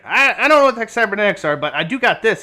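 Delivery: change the subject eagerly; a hint of a muddled old tinkerer; clueless old man. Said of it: "He's like".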